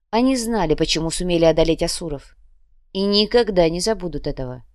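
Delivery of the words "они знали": usual speaking voice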